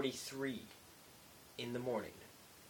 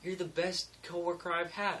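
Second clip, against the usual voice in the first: higher, bright coworker voice; lightly pitched-up quote of her compliment; imitating Amber; 7 semitones higher than usual. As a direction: raise the voice to mimic her praise. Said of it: "she was like".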